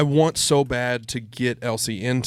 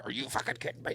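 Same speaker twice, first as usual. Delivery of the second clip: hushed, voiceless disbelief; exasperated whisper; 2 semitones lower, 14 dB quieter, faster than usual. Retold or imitated. Parent retells reaction to the child doing it again, exasperated whisper. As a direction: whisper it as an incredulous aside.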